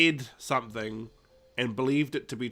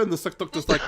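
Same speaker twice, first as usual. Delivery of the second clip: ordinary delivery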